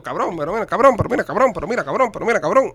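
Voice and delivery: hammed-up TV-series Cuban lines; mock Cuban series dialogue. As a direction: parody the overacted Cuban catchphrase, laughing near the end. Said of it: "de cada dos textos".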